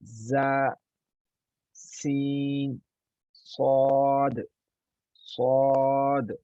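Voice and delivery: ज़ा buzzed, सीन a long thin hiss, सौद long and full-mouthed; three letters demo. Shown as a demonstration. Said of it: "तीनों लफ्ज़ को देखते हैं". (तीनों हरूफ़ का फ़र्क़ साफ़ दिखाते हुए)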